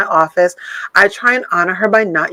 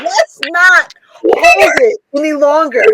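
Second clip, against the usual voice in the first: preacher; 7 semitones higher, slower than usual. Pretend. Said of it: "I'm talking to the choir".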